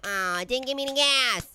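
normal talking voice

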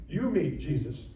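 ordinary talk, nothing marked

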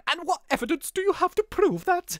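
very high, hammed-up posh voice; posh accent. Posh suspect demanding evidence from the detective, posh accent. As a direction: challenge him in an exaggerated posh accent. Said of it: "He should be really posh".

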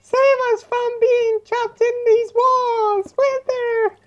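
very high, slow, pleading cry; hammed-up damsel calling for rescue; dramatic pleading voice. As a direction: beg the Wither to save you, melodramatic. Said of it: "Never do the voice".

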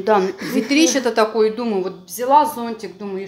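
talking between bites; muffled chewing speech; mouth full, chewing. Real, not acted. Seen 2: fork to mouth at 0.2, then puffed lips chewing while talking.

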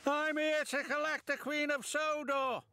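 high, self-important announcement; James announcing. A narrator's reading. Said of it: "announced James".

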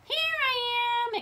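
high, slow, loud singsong call. A narrator's reading. Hidden Wilbur calls to the searchers, drawn-out high holler. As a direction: draw it out to be found. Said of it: "it called, or".

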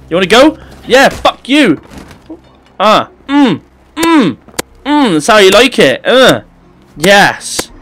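high loud taunting; trash talk. Real, not acted. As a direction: jeer at the enemy while fighting. Trash talk during fight.

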